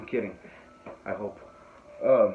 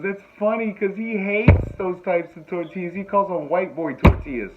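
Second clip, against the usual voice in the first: high, loud imitation of her; woman teasing; 7 semitones higher, 6 dB louder, slower than usual. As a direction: mimic her amused tattling, pitched up. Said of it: "She's laughing, goes".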